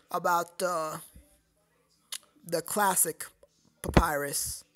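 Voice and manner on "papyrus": usual speaking voice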